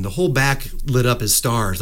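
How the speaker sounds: usual speaking voice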